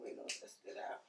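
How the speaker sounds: whispered repeat; whispering. Real, not acted. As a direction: drop to a whisper. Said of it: "whispered repeat".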